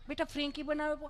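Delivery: acted fussing offer; doting parent. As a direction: imitate a parent pleading with a child. Parent offers another dish, acted.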